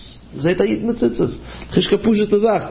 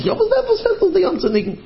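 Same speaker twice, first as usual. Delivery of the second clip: high indignant quote. Retold or imitated.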